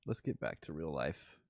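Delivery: ordinary talk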